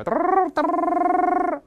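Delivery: loud, very high rolled-tongue buzz; two long piercing trills; trilled electric bell. Shown as a demonstration. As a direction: imitate an old bell's hammer rattling. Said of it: "我们摁电钮".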